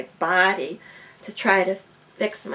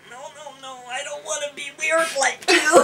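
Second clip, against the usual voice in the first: comic refusal; 7 semitones higher, 4 dB louder than usual. Retold or imitated. Student refusing the psychic's apprenticeship, in a comic high protest. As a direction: back away squeaking, then break toward laughter.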